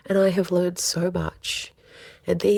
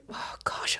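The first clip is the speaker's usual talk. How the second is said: soft breathy whisper of dismay; whispered dismay after losing your temper; whispered oh gosh; 8 dB quieter than usual. Acted. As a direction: murmur it under your breath. Voicing self-talk after anger.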